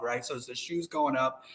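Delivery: usual speaking voice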